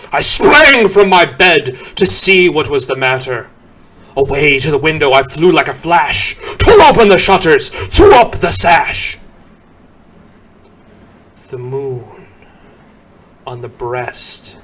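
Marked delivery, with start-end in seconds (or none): 0.1-9.2 louder, fast and eager; excited rush. A narrator's reading. Narrator reliving the rush to the window, excited and fast. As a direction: race through it like leaping out of bed. Waking to the clatter.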